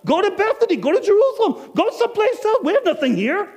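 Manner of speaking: high, loud defensive rant; a husband snapping back at his wife, high and loud; exasperated retort. Acted. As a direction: throw it back at her, fed up. Innkeeper's heated retort.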